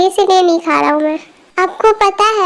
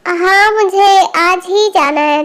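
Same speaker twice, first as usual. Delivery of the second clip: ordinary delivery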